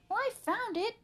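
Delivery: high, loud indignant protest; arguing. A narrator's reading. Arthur fighting Buster for the mouse, arguing. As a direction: squabble over whose turn it is. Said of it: "argued Arthur".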